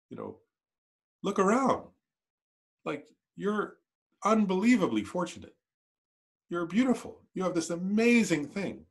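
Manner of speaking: a lightly voiced, tender Tara; voicing Tara. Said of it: "I'll do it as Tara".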